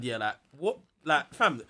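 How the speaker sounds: ordinary delivery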